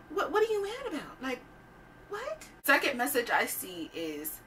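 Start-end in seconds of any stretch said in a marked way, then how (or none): 0.1-2.5 very high, small voice of mock confusion; high-pitched incredulous puzzlement; baffled questioning. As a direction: ask it in squeaky, put-on bewilderment. Acts out confusion at angry message.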